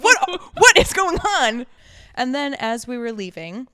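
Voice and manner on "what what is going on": loud, higher, slow disbelief; telling a friend about a stranger dancing weirdly; incredulous outburst. Retold or imitated. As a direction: stretch it out in total bafflement.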